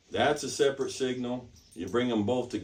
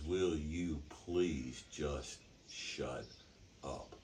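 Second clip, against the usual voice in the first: low, slow, quietly fed up; restrained exasperation, dropping very soft on the last word; irritated husband; 5 semitones lower, 10 dB quieter, much slower than usual. Acted. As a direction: drag it out softly, word by word, at the end of patience. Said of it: "He starts getting irritated".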